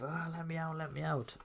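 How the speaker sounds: high, panicky mock yelp; imitating a frightened villain pleading; panicked plea. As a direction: whine to be let out in a hammed-up high voice. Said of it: "Killian's kicking off".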